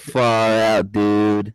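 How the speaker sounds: loud drawn-out American dude voice; mock American "dude" talk; mimicked American dude. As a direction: mimic a laid-back American, loudly. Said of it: "I just love it when they go".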